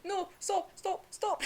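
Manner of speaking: high-pitched mock pleading; Zenitsu pleading. Retelling a friend begging him to stop, high pleading. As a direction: imitate a frantic high plea, laughing at the end.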